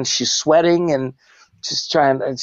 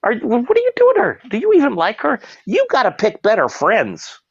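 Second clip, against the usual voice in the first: mock scolding; 9 semitones higher than usual. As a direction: call them out, half-joking. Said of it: "I will say".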